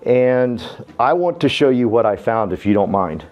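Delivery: ordinary talk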